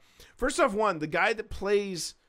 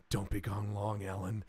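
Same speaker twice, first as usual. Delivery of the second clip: quiet mock-tender farewell; 8 semitones lower, 8 dB quieter than usual. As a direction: lower and softer, lingering. Hicks's tender farewell line.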